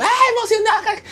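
mock excitement. Playing dumb with the call center guy, mock ecstatic. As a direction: pretend you just won the lottery. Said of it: "me hago así como que el pendejo".

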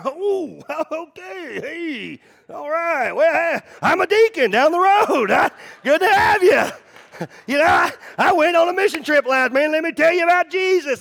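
high, loud, gushing backpedal with a laugh; overeager churchgoer. Retold or imitated. Golf stranger learns he's a pastor, high loud gushing. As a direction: suddenly play the flustered deacon, all loud and eager.

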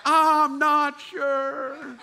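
high, slow, hesitant "I'm not sure"; doubtful whine. Retold or imitated. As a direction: draw it out like a worried doubter. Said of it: "you're like".

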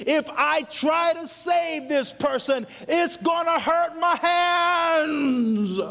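imagined teacher hesitating at the burning house; teachers' fearful thought. Retold or imitated. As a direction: drag out the fear of getting hurt. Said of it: "they were thinking".

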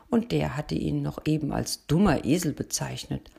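usual speaking voice

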